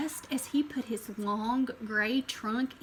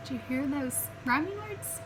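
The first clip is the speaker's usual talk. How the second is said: usual speaking voice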